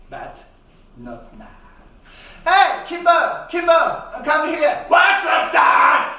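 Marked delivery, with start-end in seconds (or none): 2.4-4.8 loud, high call. Acted. Father calling his son. 4.9-6.2 loud, high bratty reply; boy answering his father, loud and high; kid shouting back. Acted.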